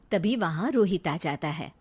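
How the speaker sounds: ordinary talk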